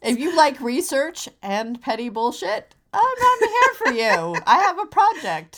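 high, loud gleeful villain pitch to listeners; hammed-up evil boss recruiting a henchman; excited pitch. Real, not acted. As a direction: sell it like a gleeful evil mastermind.

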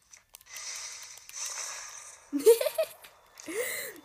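high, loud burst of laughter; breathless high laugh. Real, not acted. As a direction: cackle at the chaos. After monster chaos.

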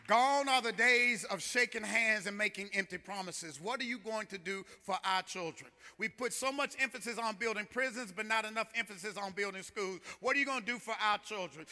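impassioned pulpit outcry; fiery preaching. Real, not acted. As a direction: preach it hard, climbing to a full-voiced demand. Preaches at full force.

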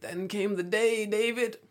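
slow, high, drawn-out storyteller; teasing, sing-out suspense; mock-dramatic build-up. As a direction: stretch it out like an epic reveal. Mock-epic storytelling repeat.

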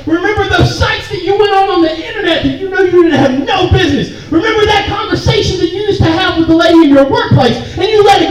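preaching build. Acted. Preaching litany at full force.

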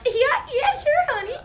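high twangy yeah, sure, honey. Pretend. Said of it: "that's my Dolly Parton impression".

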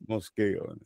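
dropped-volume, resigned delivery; mock reluctant compliance. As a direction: give in and mumble the word quietly. Gives in to the kids' request.

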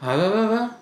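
mimicking fast English. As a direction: parrot speech you can't make out. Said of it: "they literally couldn't, like, distinguish".

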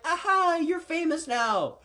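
high, put-on gushing voice, last words drawn out; high mock-celebrity voice; James Charles. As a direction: tease in a high-pitched impression. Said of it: "That's how he talks".

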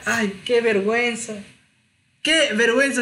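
ordinary talk

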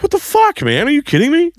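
very high, incredulous squawk; outraged disbelief. Retold or imitated. Man as stranger walks in past him, high outraged disbelief. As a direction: protest with the voice leaping right up.

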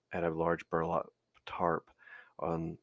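usual speaking voice

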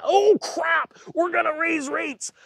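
high mock panic; mocking Fed official. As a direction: play a panicked central banker. Mocking the Fed's reaction.

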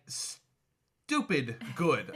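ordinary delivery

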